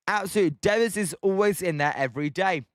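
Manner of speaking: ordinary delivery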